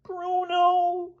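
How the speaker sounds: very high, small voice; a touch of sadness in a squeaky voice; sad echo. A narrator's reading. Boy echoing his roommate's name, high and mildly sad. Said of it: "echoed Elmer sadly".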